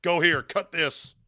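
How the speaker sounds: mock bossy investor giving commands. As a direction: bark out the orders like a pushy boss. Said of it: "an active investor who's telling him".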